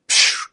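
sharp "psh" of rushing air; imitated whoosh of something flying by; whooshing sound. Storyteller mimics his pony flying past, whooshing sound.

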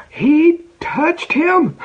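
high, loud, very slow emphatic proclamation; slow it right down and ring out each word; dramatic reveal. Acted. Preacher to congregation, Jesus touches the leper, slow triumphant proclamation. Climax of the healing story.

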